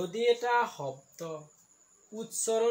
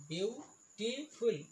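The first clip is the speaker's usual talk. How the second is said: "Beautiful" in slow, separate beats; syllable by syllable; 3 dB quieter, much slower than usual. Shown as a demonstration. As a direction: draw out each syllable of "beautiful". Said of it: "ইয়াত তিনিটা চিলেবুল আছে". ("Beautiful" চিলেবুল ভাঙি লাহে লাহে)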